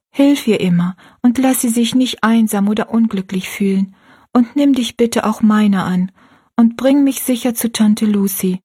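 earnest, simple prayer read aloud; a girl's heartfelt bedtime prayer; child's prayer. Acted.

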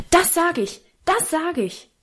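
high-pitched, excited cry; sudden high exclamation, falling at the end; calling out. A narrator's reading. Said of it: "rief Karl so laut".

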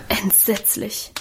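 soft, slow sigh of dismay. A narrator's reading. Adele sighs over Minchen's troubles, soft and slow. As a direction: breathe it out appalled. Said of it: "seufzte Adele".

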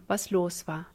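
usual speaking voice

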